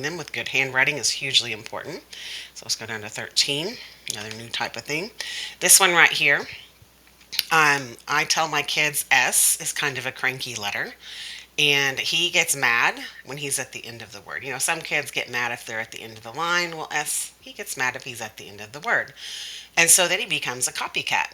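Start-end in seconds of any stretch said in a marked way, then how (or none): none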